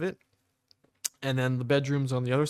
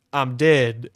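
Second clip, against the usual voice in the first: deadpan patient; 9 dB louder than usual. Retold or imitated. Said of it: "and he goes".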